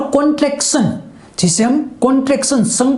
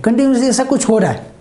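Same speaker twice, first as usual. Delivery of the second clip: ordinary talk, nothing marked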